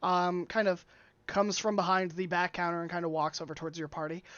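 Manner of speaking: ordinary delivery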